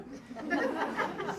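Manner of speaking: short airy laugh, high and light; a quick amused laugh; breathy high laugh. Real, not acted. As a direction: let a high laugh slip out. Laughs after asking rich friends.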